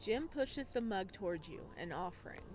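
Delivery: calm, measured storytelling voice; story narration. A narrator's reading. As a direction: read it like an audiobook narrator.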